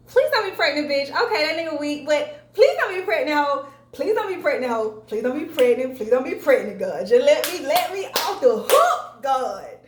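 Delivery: pleading to God, loud and high, half joking; frantic pleading. Retold or imitated. Desperately begging not pregnant.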